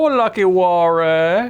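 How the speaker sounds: slow, drawn-out mock Spanish accent name; a hint of a put-on Spanish accent, slowed; lightly put-on Spanish accent, slow and stretched. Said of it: "questionably racist accent".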